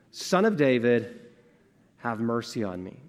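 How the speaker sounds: ordinary delivery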